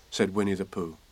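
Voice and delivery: ordinary delivery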